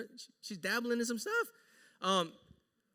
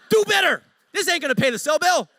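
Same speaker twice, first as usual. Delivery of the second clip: much higher, louder comic taunt; mock outrage; 7 semitones higher, 12 dB louder than usual. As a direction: yell it for laughs. Husband's taunt to barista.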